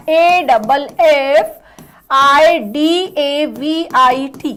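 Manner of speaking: A double F I D A V I T spelled slowly; spelled letter by letter. Shown as a demonstration. Teacher spells affidavit slowly for the class. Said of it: "वही सही spelling है".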